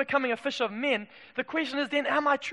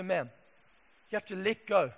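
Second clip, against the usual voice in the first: ordinary delivery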